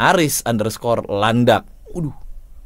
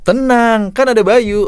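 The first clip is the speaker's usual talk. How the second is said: higher, bright stress on "Tenang"; emphasis demo; 10 semitones higher, 5 dB louder than usual. Shown as a demonstration. Coach echoing the ad line to show its emphasis, stressed high. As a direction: hit "Tenang" up high, then lean on "kan". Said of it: "asik banget ini sebenarnya, eh, emphasizing-nya".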